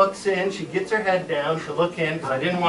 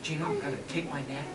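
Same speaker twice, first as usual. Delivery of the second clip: much softer, hurried hush; hushed plan; 14 dB quieter, faster than usual. Retold or imitated. Boy telling his sister the plan, hushed and quick. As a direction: keep it down, don't scare it. Sneaking up on the frog.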